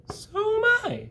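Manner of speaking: very high, slow tiny voice; tiny spider greeting the pig; small spider voice. Acted. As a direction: squeak it like a baby spider. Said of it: "said a third voice".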